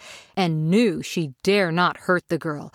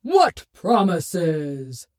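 Oz booming voice; much slower than usual. A narrator's reading. Oz speaks.

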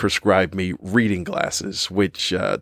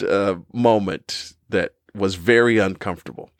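usual speaking voice

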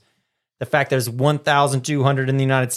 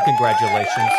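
squeaky high voice. Acted. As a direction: jump way up into a squeak.

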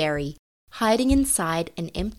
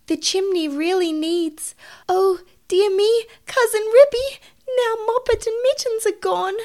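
high, rising, flustered mother cat; dismayed; 14 semitones higher, 4 dB louder than usual. Acted. Mother cat discovering two more kittens gone, high alarm. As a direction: build to a loud, high cry of dismay.

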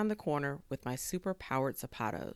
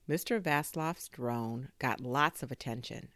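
usual speaking voice

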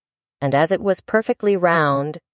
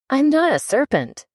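higher, mildly indignant denial; 8 semitones higher than usual. A narrator's reading. Alice denies the Pigeon's accusation, mildly indignant. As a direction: protest in a high girl's voice. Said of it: "said Alice indignantly".

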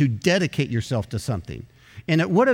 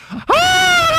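sudden high, loud yelp of pain; retold sting scream, high and loud; 7 dB louder than usual. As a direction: scream as the barb hits your hand. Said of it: "but I was screaming".

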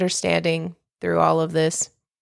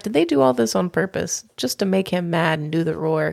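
ordinary delivery

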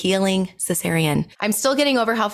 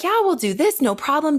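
higher, sugary accommodating nurse voice; eager nurse; 6 semitones higher than usual. Retold or imitated. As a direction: play the overly obliging nurse, bright and high.

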